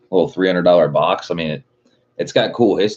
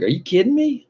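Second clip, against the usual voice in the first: squeaky high mock amazement; retold disbelief. Retelling seeing his sticker at the auto store, very high amazed. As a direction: pitch way up like you can't believe it. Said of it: "I was like".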